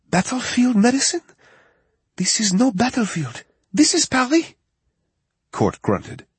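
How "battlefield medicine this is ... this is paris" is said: high, incredulous French woman; French accent. Acted. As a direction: scoff at him, Parisian accent. French vet assistant voiced.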